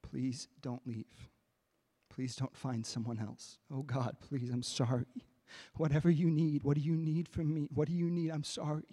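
hushed, pleading prayer; begging. Retold or imitated. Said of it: "And now I'm just begging".

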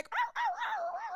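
very high mock yelps of a hurt dog; dog yelping imitation. Imitating her hurt Yorkie yelping, very high mock yelps. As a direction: squeal out the ows like a small dog.

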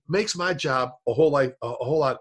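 ordinary talk, nothing marked

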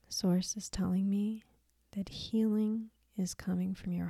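soft, unhurried meditation voice with long pauses; guided meditation. Acted. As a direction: count the breath softly, leave room to breathe.

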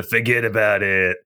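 slow New Jersey wiseguy line; New Jersey accent. Acted. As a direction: drift into a New Jersey mobster. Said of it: "it always turns into a guy from New Jersey".

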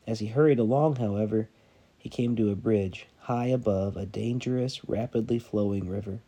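measured storytelling read, dramatic pauses; sermon story read aloud with quoted voices; story narration. A narrator's reading. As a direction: read it like a parable to the church. Pastor reads story aloud to congregation.

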